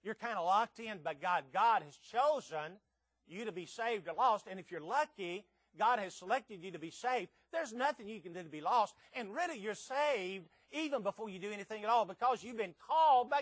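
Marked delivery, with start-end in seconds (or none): none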